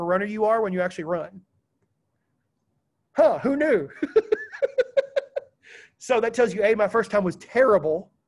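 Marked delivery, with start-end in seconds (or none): 3.1-3.9 mock-surprised, high and loud; sarcastic aside. Sarcastic aside after running story. 3.9-5.4 high burst of laughter; laughing at his own sarcastic joke; real laugh. Real, not acted.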